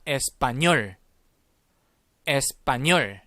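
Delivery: "에스파뇰" said clearly with weight on final "뇰"; model pronunciation. Shown as a demonstration. Teacher giving the word for Spanish to repeat, model pronunciation. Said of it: "자, 스페인어입니다".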